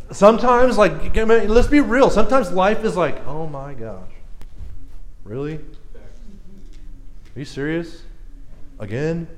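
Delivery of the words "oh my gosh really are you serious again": quiet, low, weary disbelief; exasperated disbelief. Acted. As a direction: sigh it out softly, questions rising in disbelief. Acting out exasperation at life.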